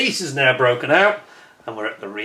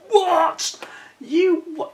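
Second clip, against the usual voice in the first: much higher, incredulous What; shocked disbelief; much slower than usual. Real, not acted. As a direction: squeal at the ten euro charge. Outraged at surcharge.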